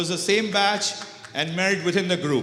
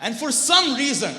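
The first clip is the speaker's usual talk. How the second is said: ordinary talk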